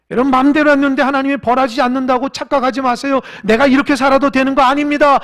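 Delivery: preaching at full force. Acted. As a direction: preach it with a catch of tears in the voice. Sermon climax. (열정적으로 외치는 설교)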